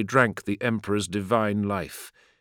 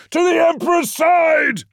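high, loud battle cry; screamed order; 5 dB louder than usual. A narrator's reading. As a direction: yell it across the battlefield. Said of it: "Ra screamed the order".

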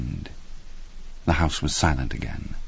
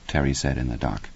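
usual speaking voice

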